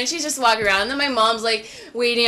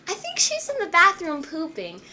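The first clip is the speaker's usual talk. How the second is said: retold innocent kid; 6 semitones higher, 3 dB quieter than usual. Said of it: "And I'm like".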